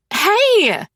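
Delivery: high, loud indignant yelp; blurted protest. Acted. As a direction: blurt it as the glass is taken. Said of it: "Calliope blurts".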